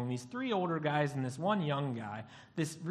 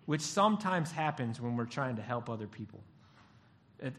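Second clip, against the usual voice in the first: ordinary delivery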